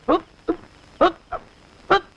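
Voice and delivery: short sharp mock grunts of blows; hup, hup, playful beating noises. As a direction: grunt out each blow like a beating. Mimicking police beating blows.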